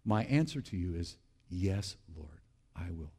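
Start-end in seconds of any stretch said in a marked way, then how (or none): 1.5-3.1 low, hushed prayerful assent; softly commit yourself to God, voice dropping; quiet surrender. Acted. Answering God's call in prayer.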